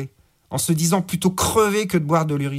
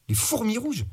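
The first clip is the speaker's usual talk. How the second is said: usual speaking voice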